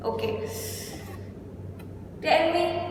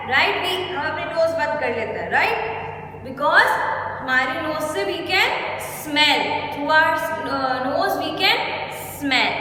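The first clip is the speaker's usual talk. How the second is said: usual speaking voice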